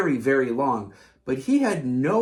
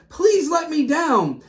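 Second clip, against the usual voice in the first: begging; 9 semitones higher, 3 dB louder than usual. A narrator's reading. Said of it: "he begged".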